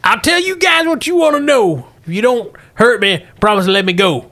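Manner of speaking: put-on high, pleading old miner; old prospector. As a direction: beg to be let go in a cracked old voice. Captured miner pleads with lawmen.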